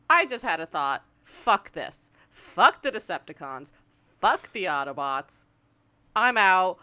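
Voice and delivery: loud, punchy mock declaration; stop-start, emphatic renouncing of everyone; defiant quitting. Villain on his own renouncing both sides, loudly defiant.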